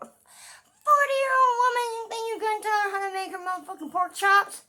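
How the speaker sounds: loud, high-pitched indignant tirade; indignant yelling, pitched very high; outraged rant. Acted. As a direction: rant at full volume, voice shooting way up. Ranting indignantly about being told how to cook.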